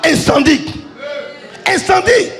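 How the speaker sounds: ordinary talk, nothing marked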